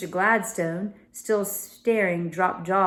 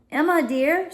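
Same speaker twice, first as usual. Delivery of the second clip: raised worried mother; Mrs Gladstone voice; 9 semitones higher, 5 dB louder than usual. Acted. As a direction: call to the girl, higher and anxious.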